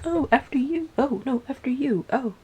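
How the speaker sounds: high, mock-polite deferring; overly courteous, lilting after-you routine; very kind. Modest horse and goat defer to each other, acted kind. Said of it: "They were very kind".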